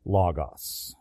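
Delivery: ordinary talk, nothing marked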